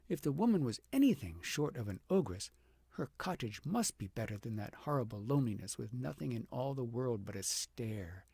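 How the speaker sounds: ordinary delivery, nothing marked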